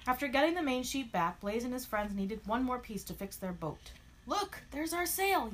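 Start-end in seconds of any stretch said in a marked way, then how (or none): 4.2-5.5 high, drawn-out mock yell. A narrator's reading. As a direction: call out a sighting to friends. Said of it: "yelled Stripes".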